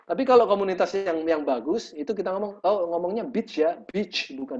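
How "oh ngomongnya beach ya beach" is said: slow "beach" with a long ee, corrected kindly; gentle correction. Shown as a demonstration. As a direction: model "beach" carefully for the learner. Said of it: "Diajarin kita yang benar". (mengoreksi pelan, "beach" dengan i panjang)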